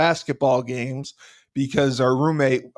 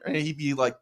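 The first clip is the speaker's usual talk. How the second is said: ordinary talk, nothing marked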